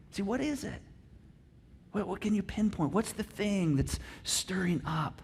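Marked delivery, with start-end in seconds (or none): none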